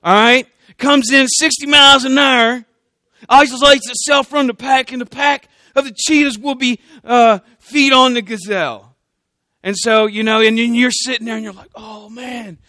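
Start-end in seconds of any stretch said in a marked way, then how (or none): 0.8-8.9 hammed-up country narrator, pitched up. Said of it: "my, my country hunting show". 11.7-12.6 hushed, much softer mock awe; awed viewer.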